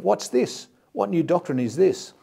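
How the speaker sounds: the crowd can't believe what they just saw; amazed. Acted. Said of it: "they were all amazed".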